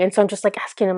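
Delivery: normal talking voice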